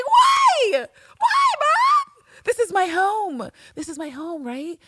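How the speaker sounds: put-on very high, shouted child's protest; hammed-up plea, shrieked then fading; mock anguished cry. Acts Villanelle protesting to mother.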